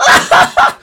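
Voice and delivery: very loud, high fake guffaw; mock banter laugh. Mocking pundits laughing at banter, loud hammed-up cackle. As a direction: shriek with exaggerated forced laughter. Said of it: "And then some shit banter".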